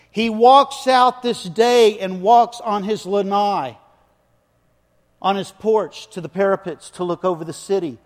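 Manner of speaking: full-force preaching. Acted. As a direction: preach it big to the back row. Preaching David's story at full force.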